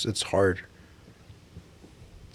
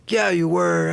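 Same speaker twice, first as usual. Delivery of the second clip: high, louder mock accusation; mock accusing clerk; 8 semitones higher, 3 dB louder, slower than usual. Clerk accusing the boy, high mock scold. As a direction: voice the clerk cutting him off. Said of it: "'cause he got all like".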